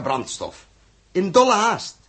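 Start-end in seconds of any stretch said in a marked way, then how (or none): 1.1-2.0 urgent. Acted. Urgent radio play narration.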